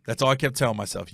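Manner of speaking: normal talking voice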